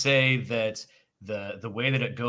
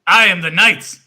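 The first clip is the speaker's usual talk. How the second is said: loud, raised, hammed-up superhero declaration; Batman-style hero entrance; 9 semitones higher, 13 dB louder than usual. Guest announcing himself after introduction, hammed-up hero shout. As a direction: boom out each title like a masked avenger.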